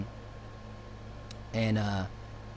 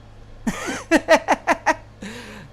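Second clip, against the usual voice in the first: real laugh; 10 semitones higher, 4 dB louder than usual. Real, not acted. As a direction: burst of high laughter. Laughs at his own story.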